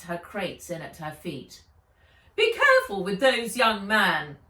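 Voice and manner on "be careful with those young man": loud, high, sung-out operatic call. A narrator's reading. Fred warning Altan about the crates, in a loud high operatic voice. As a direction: belt it out like an opera singer. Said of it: "sang out Fred in a high operatic voice".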